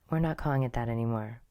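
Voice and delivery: ordinary talk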